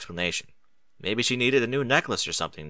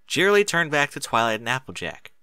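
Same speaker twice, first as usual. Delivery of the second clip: ordinary delivery, nothing marked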